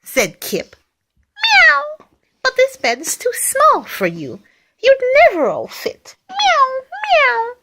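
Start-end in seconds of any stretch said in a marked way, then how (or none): 1.3-7.5 high, mewing cat voice; storybook cats. Acted.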